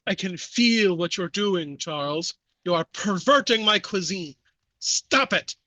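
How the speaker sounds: put-on heavy French accent, building in pitch; Jacques. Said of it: "heavy French accent".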